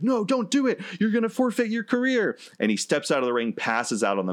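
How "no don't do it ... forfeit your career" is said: very high-pitched mock panic; hammed-up alarmed warning, pitched way up; frantic referee. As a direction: imitate a referee desperately pleading. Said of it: "the referee's like".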